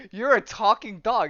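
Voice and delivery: acted amazement at a talking animal; astonished. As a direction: state it in stunned disbelief. Man astonished that dog talks.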